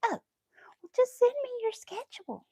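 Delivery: high-pitched mom impression; mom's voice. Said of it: "She was like".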